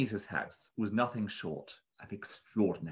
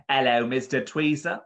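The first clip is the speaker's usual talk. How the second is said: louder, higher bird keeper voice; pleasant bird keeper; 5 semitones higher, 6 dB louder than usual. A narrator's reading. Bird keeper welcomes Mister Tweezer to the shop, pleasantly. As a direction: greet a customer as a pleasant shopkeeper. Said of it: "pleasant bird keeper".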